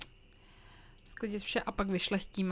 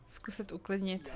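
whisper; 2 semitones lower, 7 dB quieter than usual. Real, not acted. Reading game options to herself.

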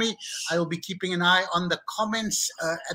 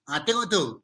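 ordinary talk, nothing marked

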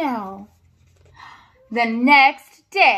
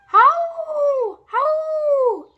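much higher, long falling howls; wolf howls; 12 semitones higher than usual. Acted. Wolves answer the dog with high falling howls. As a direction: howl back like a far-off wolf pack.

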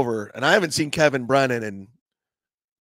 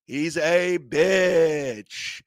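slow emphatic; 2 semitones higher, much slower than usual. Real, not acted. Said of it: "repeated for emphasis".

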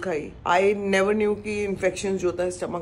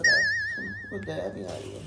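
squeaky mock complaint. Complaining friend mocks her.

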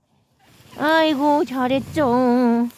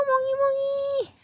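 high sing-song calling a dog; high-pitched dog call; 12 semitones higher than usual. Real, not acted.

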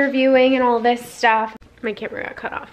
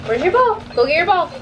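sing-song urging to go get the ball; urging the dog in a high voice; high coaxing voice; 11 semitones higher than usual. Real, not acted.

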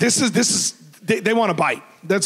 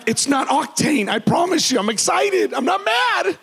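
mock-excited insistence, climbing much higher; excited protest; 7 semitones higher than usual. Husband swearing to his wife he isn't shouting, excited high-pitched protest. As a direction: plead your case, pitch rising with every phrase. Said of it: "I'm just really excited".

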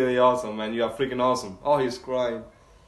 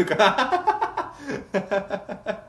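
long, high fit of laughter; 4 semitones higher than usual. Real, not acted. Amused reaction to the episode.

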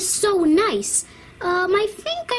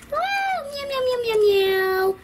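meows squeaked up high; high cat meows; 8 semitones higher than usual. Acted.

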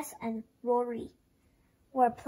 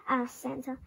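ordinary delivery, nothing marked

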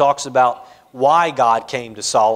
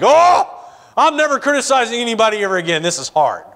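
dismayed realization; 6 semitones higher, faster than usual. Retold or imitated. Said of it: "it's like".

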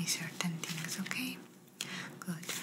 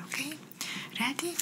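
ordinary talk, nothing marked

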